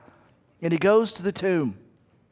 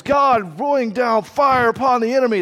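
David's battle cry; 6 semitones higher, 5 dB louder than usual. Retold or imitated. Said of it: "where he goes".

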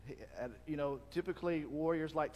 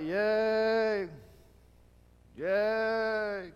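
hollow higher yay without conviction; insincere cheer; 5 semitones higher, 8 dB louder than usual. Retold or imitated. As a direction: cheer because you're supposed to. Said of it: "But they don't mean it".

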